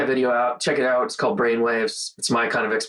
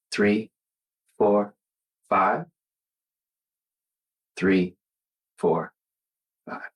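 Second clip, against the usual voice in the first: slow, lower breath count with long pauses; calm counting; 4 semitones lower, slower than usual. Real, not acted. Leading viewers through the reset, calm lower counting. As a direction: count the breaths like you're settling down. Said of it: "You can already hear in my voice".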